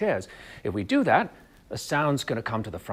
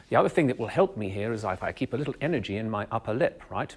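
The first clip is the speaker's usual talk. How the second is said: usual speaking voice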